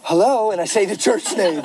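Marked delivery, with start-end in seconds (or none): 0.1-0.5 higher phone-answering voice; put-on cheerful greeting. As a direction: answer as if everything's fine. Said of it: "I put on a voice".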